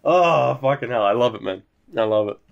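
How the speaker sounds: ordinary talk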